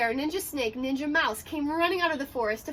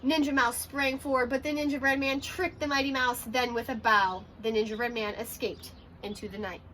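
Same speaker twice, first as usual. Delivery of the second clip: ordinary delivery, nothing marked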